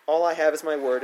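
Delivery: plain reading voice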